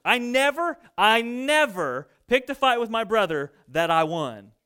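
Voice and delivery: ordinary talk, nothing marked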